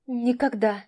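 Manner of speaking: shy, mumbled «Никогда». Acted. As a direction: mumble it, barely opening the mouth. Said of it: "промямлила Таня".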